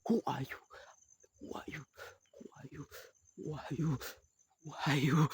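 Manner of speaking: high demanding repeated question; ogre voice. Acted. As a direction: demand it like a monster.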